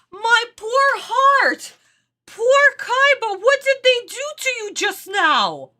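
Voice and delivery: high, drawn-out lament over a favorite character; distraught wailing. Real, not acted. Fan mourning what was done to poor Kaiba, distraught. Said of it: "I'm gonna cry".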